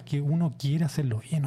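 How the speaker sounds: ordinary delivery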